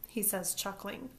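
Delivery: usual speaking voice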